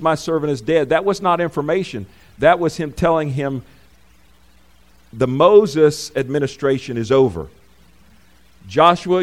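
rising fervent sermon delivery; full-force preaching. Acted. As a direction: preach it out to the congregation, building. Preaching builds, louder and higher.

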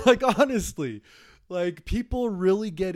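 said high and loud through laughter; laughing. Real, not acted. Still laughing at his own rant to the listener. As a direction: still giggling while talking.

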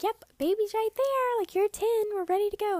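high, loud bright nurse voice; nurse voiced high and loud. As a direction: chirp it like a cheerful nurse. Nurse voiced, announcing birth.

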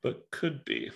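talking with hiccups; hiccuping between words. Real, not acted. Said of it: "got the hiccups now".

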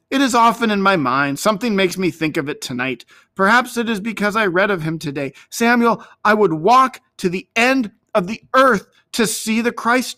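put-on high, earnest young voice; eager boy. A narrator's reading. David confides his longing to the older shepherd, eager and high.

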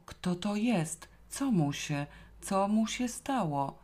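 high, slow, bewildered questions; stunned questioning. A narrator's reading. Zosia questions the sight of a corpse, stunned. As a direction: ask slowly, dazed by the shock. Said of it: "jęknęła w oszołomieniu".

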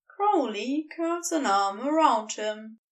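usual speaking voice

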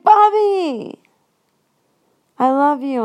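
high, loud joyful cry; excited. Retold or imitated. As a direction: burst out with delight. Joyful hug in story.

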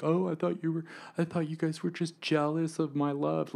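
high mock parent lament. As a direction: parody a self-pitying parent.

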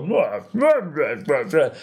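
mock film-clip babble, starting high; lightly imitated comic babble; Mister Bean. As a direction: blurt nonsense like a silly video clip. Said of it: "like, Mister Bean Goes to America video".

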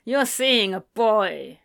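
a touch of held-back anger, slow; fuming father, pressing each word slowly; lightly fuming. A narrator's reading. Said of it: "a fuming James Crowley".

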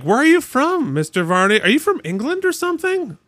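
mimicking curious kids. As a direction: play the kids quizzing their teacher. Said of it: "the kids were asking me".

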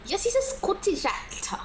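high-pitched mock British accent; playful British accent. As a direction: answer in a put-on British voice. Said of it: "saying that in a British accent".

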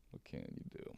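whispered "What can you do?" with no voice. Acted. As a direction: breathe it out as a secret. Whispered aside, then repeated.

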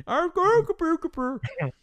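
high mock Swedish Chef babble through a laugh; hammed-up Muppet chef gibberish; laughing Swedish Chef nonsense. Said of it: "Yeah, Swedish chef".

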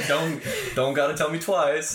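laughing out the words in a high, loud voice; cracking up mid-sentence. Real, not acted. A friend laughing at the hookup story.

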